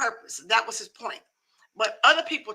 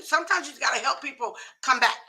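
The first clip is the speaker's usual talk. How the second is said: ordinary talk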